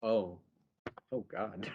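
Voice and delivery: low, soft, halting "oh God"; retold quiet dismay. Charles walking in on Jan undressed, quiet mock dismay. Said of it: "And Charles is like".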